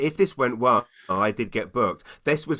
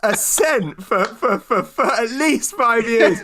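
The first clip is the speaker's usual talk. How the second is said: exasperated high protest; 9 semitones higher, 5 dB louder than usual. Retold or imitated. Exasperated reply to wife.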